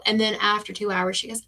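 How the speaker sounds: ordinary talk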